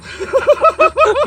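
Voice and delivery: high burst of laughter; loud laugh. Real, not acted. Guest laughs.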